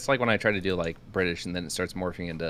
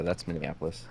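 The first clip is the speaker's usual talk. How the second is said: normal talking voice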